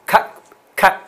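cup said breathy and high, short vowel like uh, not up; correct pronunciation demo. Shown as a demonstration. Teacher correcting students' cup, crisp high model. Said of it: "它正确是这样".

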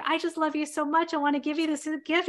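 wife handing her husband a gift she loves; eager gift giver. Retold or imitated. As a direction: rush it out like a wife bursting to give her present. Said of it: "and I'm like".

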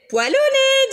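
put-on high, loud teasing girl's voice; cheeky little girl. Little Lucille teasing the monster, high and loud. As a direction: chirp it up high and loud, mocking. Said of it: "dit Lucille".

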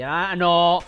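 frantic repeated no, rising loud; panicked no. Real, not acted. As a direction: panic as the ants close in. Panicking as ants attack.

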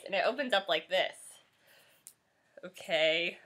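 usual speaking voice

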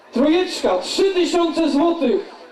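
high, slow bid call; auctioneer. Acted. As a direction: announce the new bid slowly.

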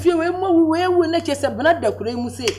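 high, pressing lament; distraught outcry. Acted. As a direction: cry out over the deaths, pitch way up. Distraught outcry over many deaths.

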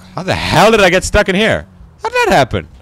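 loud, high-pitched disbelief; exasperated outburst. Real, not acted. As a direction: yell it in frustrated bafflement. Stuck in the game, exasperated.

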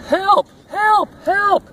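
high-pitched, loud little truck cries; storybook cry of alarm; crying for help. A narrator's reading. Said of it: "cried the little blue truck".

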